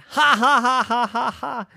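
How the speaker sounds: loud high villain cackle; hammed-up villain cackle; evil laugh. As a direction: gloat with a big bad laugh. Villain gloats after crash.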